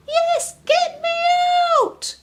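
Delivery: very high, slow frightened squeal; trapped spider begging to get out; terrified shriek. Acted. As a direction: shriek in terror. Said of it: "shrieked a terrified spider".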